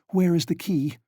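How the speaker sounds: frantic high demand. A narrator's reading. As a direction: insist on the key, urgently. Donya demanding the key.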